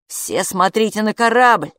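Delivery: ordinary delivery, nothing marked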